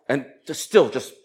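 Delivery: ordinary talk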